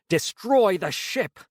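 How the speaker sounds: slow, high shout held back; bursting out, then reined in; restrained scream. A narrator's reading. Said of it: "I wanted to scream out".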